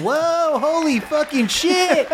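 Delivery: high, drawn-out amazed exclamation; awed onlooker. Acted. Bullywug crowd watches an ice beam shot, amazed. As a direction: stretch it out in high-pitched awe.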